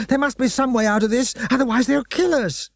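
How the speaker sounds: high, frightened pleading; panicked boy. Acted. Lloyd fearing for their lives, high and panicked. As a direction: fear rising in the voice.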